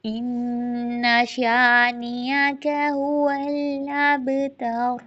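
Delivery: slow, melodic tajweed recitation; Quran recitation. Acted. Teacher recites surah for students in slow melodic tajweed. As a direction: chant each verse slowly and clearly.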